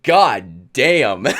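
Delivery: loud exclamation breaking into laughter; laughing disbelief. Retold or imitated. Boyfriend reacting to girlfriend's temper, laughing disbelief. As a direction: blurt it out loud, tipping into a laugh.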